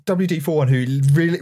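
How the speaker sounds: usual speaking voice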